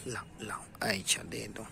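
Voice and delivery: hushed whisper, no voice at all. Real, not acted. Mother whispering to her toddler to hand it over.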